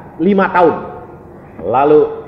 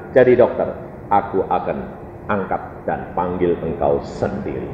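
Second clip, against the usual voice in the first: low, soft, solemn promise; suara Tuhan tenang; 11 semitones lower, 7 dB quieter than usual. Retold or imitated. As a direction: drop to a hushed, deep assurance. Acts God's promise. (janji lirih dengan suara rendah)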